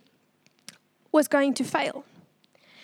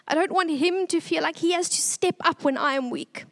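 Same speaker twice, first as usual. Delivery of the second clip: ordinary delivery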